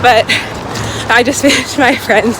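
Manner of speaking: breathless running talk; talking mid-jog, winded; while running. Real, not acted. Talking mid-run between miles.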